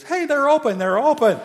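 high, loud excited holler; thrilled, high-pitched shout; excited calling out. Acted. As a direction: call the good news across to friends. Friend runs back announcing restaurant open.

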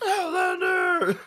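very high, slow wailing call; Outlander shout. Retold or imitated. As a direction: wail it out slowly. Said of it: "I just gotta repeat that".

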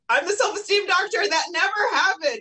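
high-pitched put-on expert voice; mock self-important denial. Doctor showing what she won't say to her kids, mock denial.

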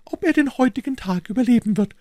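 put-on high, worried old housekeeper voice; fretting landlady pleading urgently; anxious housekeeper.

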